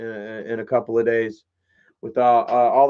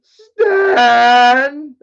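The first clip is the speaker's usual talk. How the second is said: loud, much higher shout over music; calling out; 9 semitones higher, 11 dB louder than usual. Shown as a demonstration. Shouting Stan's name once more for the message. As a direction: call his name out again, hard. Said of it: "That's what I'm gonna say".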